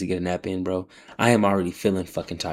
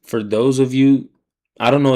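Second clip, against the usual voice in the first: loud call-out; 4 semitones higher, 8 dB louder than usual. Real, not acted. Streamer addressing his audience.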